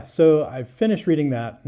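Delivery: ordinary delivery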